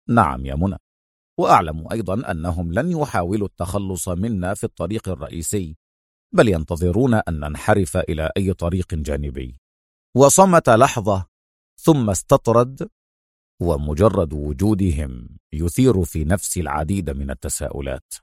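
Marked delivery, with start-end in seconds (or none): 0.1-9.6 low, soft, unconcerned hero; simple, matter-of-fact. A narrator's reading. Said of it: "أجابها في بساطة". 13.6-18.1 low, hushed, thoughtful hero; calm hero. A narrator's reading. Calm hero muses aloud to partner.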